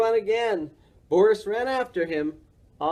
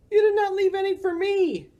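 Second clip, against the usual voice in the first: much higher character voice; moose voice complaining. A narrator's reading. Morris whines that nobody left him any apples. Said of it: "Morris yelled".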